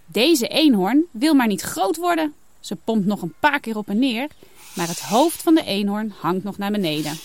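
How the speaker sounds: plain reading voice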